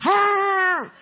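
high, drawn-out mock gasp; re-enacted shocked gasp. Preacher relives spotting husband's enemy at checkout, mock gasp. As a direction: gasp in exaggerated dismay on a high pitch.

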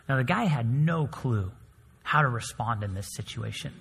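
ordinary talk, nothing marked

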